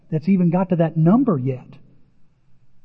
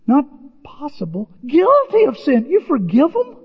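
much higher, loud disbelief at forgiveness; incredulous; 8 semitones higher, 3 dB louder than usual. Real, not acted. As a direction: ask it like you can't believe it.

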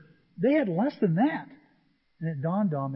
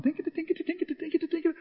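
tinkety noise; 10 semitones higher, much faster than usual. Retold or imitated. Imitating the ride's tinkling sound.

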